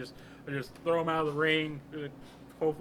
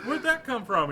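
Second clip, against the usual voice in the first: high, louder comic movie quote; mock bewilderment; 9 semitones higher, 5 dB louder than usual. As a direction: play baffled, voice pitched up. Quoting puzzled film character, laughing.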